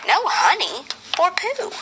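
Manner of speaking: plain reading voice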